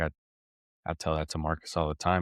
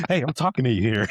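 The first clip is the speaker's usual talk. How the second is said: louder, higher mock indignation; retold calling after; 7 semitones higher, 6 dB louder, faster than usual. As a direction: call after a kid walking off, amused. Calls after departing kid.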